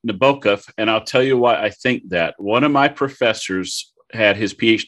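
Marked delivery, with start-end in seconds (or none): 0.1-0.7 Nabokov with the middle syllable stressed, nuh-BOH-kof; pronunciation demo. Shown as a demonstration. Guest answering how he pronounces the author's name, demonstrating Nabokov as nuh-BOH-kof. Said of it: "you're supposed to pronounce it".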